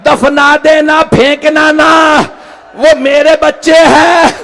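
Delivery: lamenting preacher, rising in intensity toward the end; impassioned mourning sermon. A narrator's reading. Preacher recounts the martyred boys to mourners, impassioned lament building.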